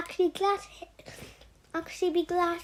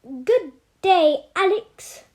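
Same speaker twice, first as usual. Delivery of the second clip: put-on loud, hearty Australian accent; 3 semitones higher, 10 dB louder, much slower than usual. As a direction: greet the boy in an Aussie drawl. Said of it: "He spoke with an Australian accent".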